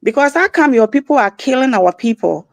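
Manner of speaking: normal talking voice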